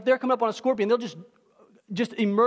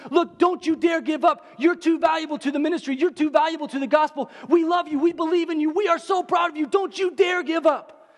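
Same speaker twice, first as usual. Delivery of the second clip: a raised, pleading pep talk; Titus urging Paul not to give up, raised voice; fervent encouragement; 6 semitones higher than usual. Retold or imitated. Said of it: "Titus goes to Paul and he says".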